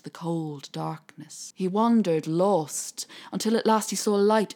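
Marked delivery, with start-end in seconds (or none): none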